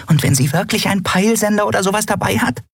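very high, fast, nervous little creature; tiny fluttering creature. Acted. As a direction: chatter like an excited tiny winged pet. Nelen, kleines flatterndes Wesen. (sehr hohe, schnelle, aufgeregte Stimme eines kleinen Flugwesens)

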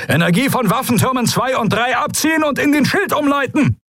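very high, strained commanding yell; shouted battle order. A narrator's reading. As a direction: bellow instructions to the bridge crew.

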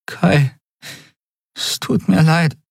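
halting, high-pitched tearful apology; mit schwerer Zunge. A narrator's reading. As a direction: bring it out with difficulty, pausing after the name. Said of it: "brachte sie mit schwerer Zunge hervor". (stockend und hoch, den Tränen nah)

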